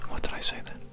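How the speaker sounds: ordinary talk, nothing marked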